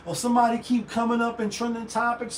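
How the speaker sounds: ordinary talk, nothing marked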